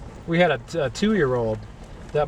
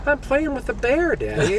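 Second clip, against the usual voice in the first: put-on little girl voice; 10 semitones higher than usual. Voicing his ten-year-old daughter.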